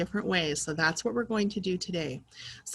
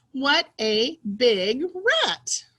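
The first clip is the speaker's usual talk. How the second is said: slow, emphatic excited exclamation; excited reading; 3 semitones higher, 4 dB louder, much slower than usual. Shown as a demonstration. Teacher rereading the rat sentence for pupils, excited. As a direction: draw it out word by word with excitement. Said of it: "say it in an excited way".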